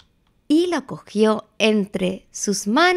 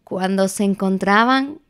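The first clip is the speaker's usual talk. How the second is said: plain reading voice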